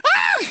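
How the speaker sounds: very high loud mock shriek; scream. Friend imitates her screaming at the maggot apple. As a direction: shriek like you just saw maggots. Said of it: "you said".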